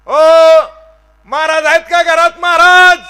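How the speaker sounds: high, loud hail toward the house; calling out loudly. Retold or imitated. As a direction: shout up to the house from the yard. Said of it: "आवाज दिला".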